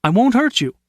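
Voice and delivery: reassuring and friendly. A narrator's reading. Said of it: "invited in a friendly voice".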